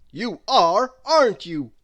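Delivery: loud, hearty giant's hello; Mister Strong's voice. A narrator's reading. Mister Strong greeting tiny Miss Tiny, hearty and loud. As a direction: greet her big and booming. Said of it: "smiled Mister Strong".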